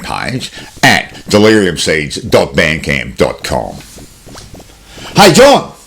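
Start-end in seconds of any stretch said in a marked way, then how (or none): 5.2-5.7 calling out. Acted. Host calling to co-host.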